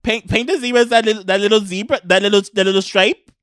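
loud, high-pitched cartoon Canadian accent; Minnesota-Canadian accent. Advising a neighbour on painting zebras, Minnesota-Canadian accent. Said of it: "The, the Canadians".

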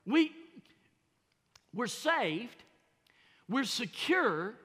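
loud, high preacher's cry; fervent preaching. Acted. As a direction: preach it out to the whole church. Opening preaching at full force.

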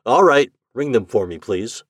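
crisp and direct, giving orders to a machine; Shandor's voice. A narrator's reading. Shandor asks the library index for files, brisk.

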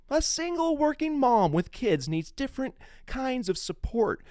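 reading an op-ed aloud. Acted. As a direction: read her words out with an edge. Reads the op-ed aloud.

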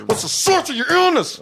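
squeaky high playful demand; shrill demand. Acted. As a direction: shriek the question up high. Repeated demand, much higher.

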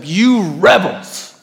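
slow, scornful rebuke; tongue-lashing. Acted. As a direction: draw the accusation out at the crowd. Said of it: "this tone".

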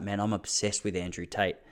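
understated female friend; quoting friend softly. Retold or imitated. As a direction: lightly voice a quiet woman. Voicing Lucy, soft.

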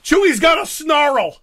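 high, loud and slow fussing; yelling across the room. Pretend. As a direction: call out about the tangled fur. Fussing over the Chewbacca figure.

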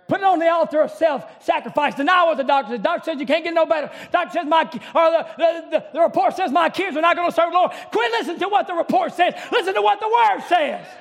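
loud, rising sermon cadence; fiery revival preacher; preaching at full force. Acted. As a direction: preach it hard to the congregation. Preacher building to a climax.

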